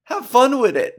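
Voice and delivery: high, bright catchphrase pop. Shown as a demonstration. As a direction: toss it off like a signature line. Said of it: "That's what I always say".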